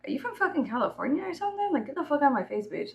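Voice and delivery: high, quick, imitated challenge; mock hostile Texan. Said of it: "they're gonna be like".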